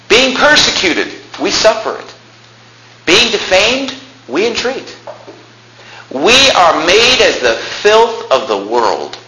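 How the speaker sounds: high, slow, impassioned verse reading; preaching scripture reading. Acted. Preacher reading Paul's sufferings to congregation, impassioned. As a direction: declaim each phrase high, with long pauses.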